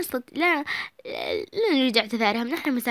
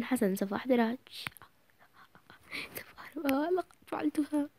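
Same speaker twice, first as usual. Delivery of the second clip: soft, secretive whisper; sneaky whisper; 8 dB quieter than usual. Acted. Girl sneaking to steal the boys' ball, whispering. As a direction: creep in and whisper.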